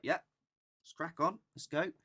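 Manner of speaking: high, loud, bouncy yes; eager agreement. Acted. Ghost hunter accepting a friend's invitation, high eager reply. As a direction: jump at the invitation with mock gusto.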